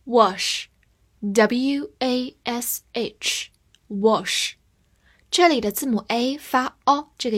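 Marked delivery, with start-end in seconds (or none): 0.1-4.5 slow letter-by-letter spelling; drawn-out dictation; spelled out slowly. Shown as a demonstration. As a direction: spell each letter out for the class. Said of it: "spelling out the word".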